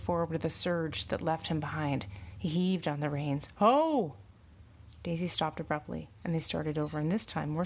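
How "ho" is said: loud, high halting call. A narrator's reading. Rider halts his horse, calling out loudly. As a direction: call out sharply to stop a horse.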